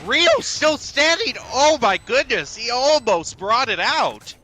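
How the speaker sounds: rising hype over the clutch; esports caster. Real, not acted. Clutch moment in the round.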